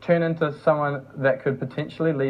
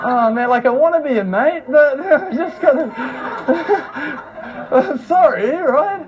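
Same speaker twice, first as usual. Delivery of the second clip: laughing mock apology; 10 semitones higher, 4 dB louder than usual. Mock reply to weird message.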